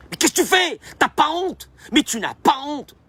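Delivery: high-pitched mock outrage; indignant scolding. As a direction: scold them as if shocked by their behaviour. Acted scolding of the anxious person.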